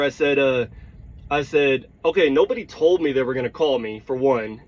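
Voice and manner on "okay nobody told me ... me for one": usual speaking voice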